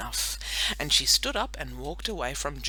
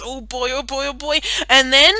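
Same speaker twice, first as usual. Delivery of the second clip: excited; 10 semitones higher, 12 dB louder than usual. Acted. Excited about the cake.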